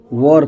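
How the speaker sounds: वॉर in one syllable with rounded "o"; low-pitched वॉर, said as "vor"; correct pronunciation. Shown as a demonstration. Teacher answering own drill question, correct pronunciation. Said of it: "क्या पढ़ते हैं?".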